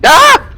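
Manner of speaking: sudden high scream into a laugh; startled scream. Real, not acted. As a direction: shriek then laugh from a scare. Jump scare in game.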